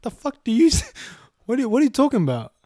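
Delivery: high, loud disbelieving outburst; stunned retort. Retold or imitated. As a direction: hit it like you can't believe your ears. Shocked retort to her question.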